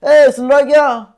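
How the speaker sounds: high and loud hailing shout; loud call. Shown as a demonstration. Teacher demonstrating calling loudly from Jaipur. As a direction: call it loudly toward a distant town. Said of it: "जोर से बोलोगे".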